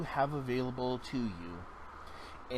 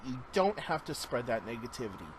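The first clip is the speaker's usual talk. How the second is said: ordinary delivery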